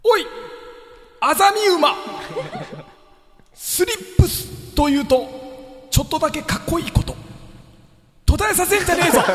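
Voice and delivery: high, loud raspy shout; hoarse voice. Shown as a demonstration. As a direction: yell it like a gruff old farmer. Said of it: "しゃがれた声で言います". (しゃがれた声で叫ぶ)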